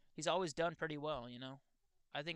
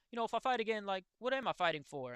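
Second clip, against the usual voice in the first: higher, louder retold plea, mildly emotional; quoting Poirier; 6 semitones higher, 4 dB louder than usual. Retelling a fighter's post-fight words, higher and louder. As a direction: lightly voice the fighter's doubt, pitched up. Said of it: "but he's like".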